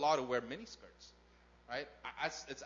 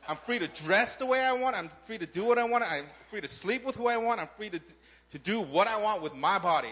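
higher, louder, defiant "I'm free"; mocking self-assertion; 5 semitones higher, 7 dB louder than usual. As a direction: mimic a brash individualist. Voicing society's attitude.

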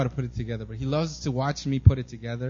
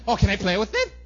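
put-on higher, louder kid voice; eager child impression, pitched up; imitated eager child; 6 semitones higher, 6 dB louder than usual. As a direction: play an excited kid asking. Acting out other son's excited question.

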